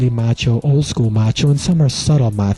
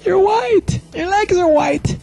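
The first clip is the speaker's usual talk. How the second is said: put-on much higher, stunned girlfriend voice; shocked girlfriend; slower than usual. Girlfriend shocked by his legs.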